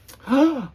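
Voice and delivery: sharp high startled gasp. Acted. Reading to kids, Grover gasps as the page turns. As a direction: gasp in fright, high and loud.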